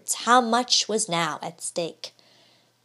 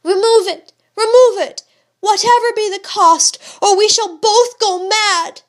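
very high, loud desperate pleading; frantic plea; 11 semitones higher, 10 dB louder than usual. A narrator's reading. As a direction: beg him wildly to remove it.

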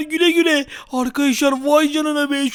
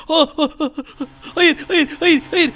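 usual speaking voice

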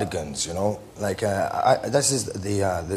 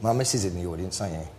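ordinary talk, nothing marked

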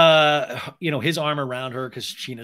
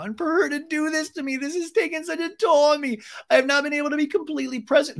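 mock crying; 13 semitones higher than usual. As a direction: imitate someone crying hard, hammed up. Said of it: "Sheena is crying hard now".

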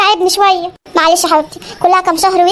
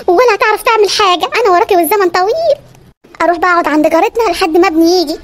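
ordinary talk

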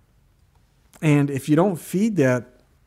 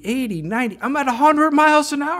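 alarmed excitement; 12 semitones higher, 6 dB louder than usual. Retold or imitated. Speeding alarm in story.

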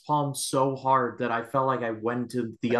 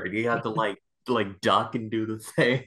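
ordinary talk, nothing marked

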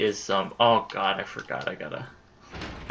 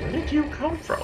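very high and softer, caught off guard; startled squeak; 5 dB quieter than usual. Real, not acted. Player squeaks as an enemy appears, very high and soft. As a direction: let it pop out high and startled.

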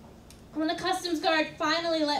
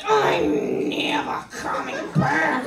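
strained whisper; 4 dB louder, slower than usual. Retold or imitated. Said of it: "It was more like".

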